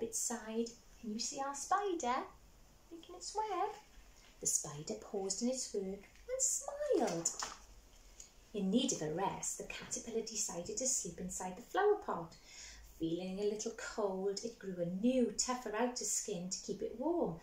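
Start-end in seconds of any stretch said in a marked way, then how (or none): none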